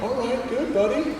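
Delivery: high, sing-song reply; awkward upbeat adult. Retold or imitated. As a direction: chirp it awkwardly. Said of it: "they're like".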